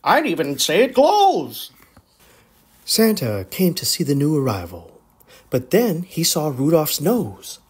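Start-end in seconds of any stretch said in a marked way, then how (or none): 0.0-1.7 amazed. Acted. Donner continues amazed.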